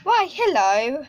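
a hint of a bouncy little bunny; voicing the toy rabbit. Child voicing Peter Rabbit toy for her pet, playful. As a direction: hop it out, then a bright high hello. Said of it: "playing with him with this Peter Rabbit toy".

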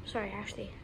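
hushed whisper near target. Real, not acted. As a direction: secret prankster whisper. Whispering to camera.

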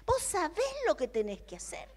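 loud, high preaching voice, slowing on key words; fervent preaching. Acted. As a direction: preach it with rising fervor. Preaches to the congregation.

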